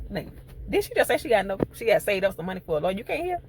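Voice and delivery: high, fast, disbelieving rant at the screen; can't believe what she just heard; exasperated squeak; incredulous. Retold or imitated. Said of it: "And I'm like".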